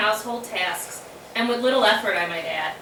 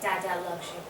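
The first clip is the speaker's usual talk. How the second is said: much softer, hushed loving murmur; soft whisper; 4 semitones lower, 9 dB quieter, faster than usual. Retold or imitated. Sister imitating brother whispering to his baby son, soft. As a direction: breathe it like into a baby's ear. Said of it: "repeatedly whispering in his ear".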